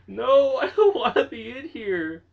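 scared laugh. Real, not acted. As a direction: laugh in fear. Panic inside scary house.

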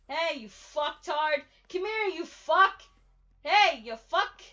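loud jeering call-out; taunting shout. Real, not acted. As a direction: yell to bait the killer. Taunting the killer, calling out.

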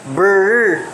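"Brr" with B running into a held R; blended B-R sound. Shown as a demonstration. Teacher showing a blend to class, B-R run together. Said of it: "Ma-pronounce man yun na nimo sila".

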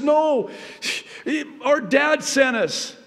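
high, loud pleading protest. Acted. Brothers loudly protesting to Joseph, high and pleading. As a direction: cry out in protest.

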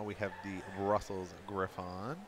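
ordinary talk

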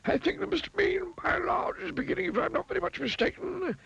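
very high, rambling wizard voice; musing wizard. A narrator's reading. Wizard judging whether Mister Mean has learned, musing. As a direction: think aloud pompously, pitched high. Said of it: "he said to himself".

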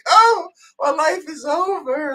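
high, slow, overdone despair; melodramatic Bertha. Pretend. Mocking Bertha flinging herself on the bed, in a high, slow melodramatic wail. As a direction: wail it like a spoiled heroine flopping onto the bed.

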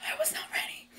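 high squeaky whisper, nearly voiceless; overwhelmed whisper. Real, not acted. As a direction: voice gives out into a stunned whisper. Overwhelmed by singer's early entry.

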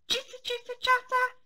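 high chittering squirrel sound; squirrel chatter. A narrator's reading. Squirrel noise in story.

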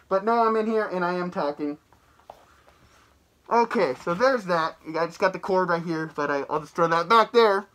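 muffled behind full-head mask; speaking inside rubber mask; through a latex mask. Real, not acted. Seen 0: Full latex mask covers whole head and mouth throughout labelled words.

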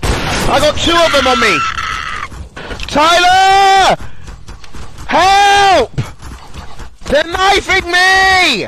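desperate yelling. Real, not acted. Calling teammate while being knifed.

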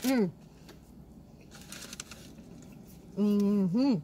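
humming while chewing. Real, not acted. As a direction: mouth full of food. Seen 1: Cheeks full, lips closed, chewing the burrito bite while humming.

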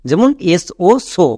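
ordinary delivery

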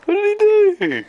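high, slow squeaky voice. Acted. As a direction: squeak it out slowly. Squeaky mock voice, laughing.

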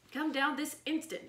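high, strained holler upward. A narrator's reading. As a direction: yell at the kids up the ladder. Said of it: "Miss Crowley hollered".

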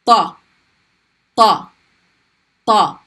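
Ta repeated, emphatic and heavy; heavy ta, tongue pushed up; heavy T drill. Shown as a demonstration. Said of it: "heavy T sound".

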